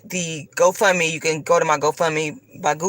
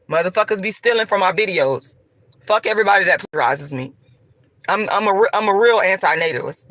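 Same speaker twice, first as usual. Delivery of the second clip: ordinary talk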